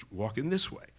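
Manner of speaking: ordinary delivery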